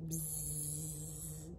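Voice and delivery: soft low buzzing like a bee. Shown as a demonstration. Librarian answering what bees say, soft bee buzz. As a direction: buzz like a little bee for the kids. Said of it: "What do bees say?".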